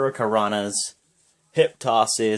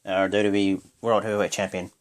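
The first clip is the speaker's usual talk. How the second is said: usual speaking voice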